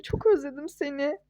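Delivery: ordinary delivery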